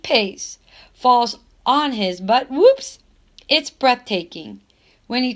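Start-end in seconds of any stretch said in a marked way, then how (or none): 2.5-3.0 high, loud whoops; excited whoops. A narrator's reading. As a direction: yelp it as he falls. Defender falls on his butt.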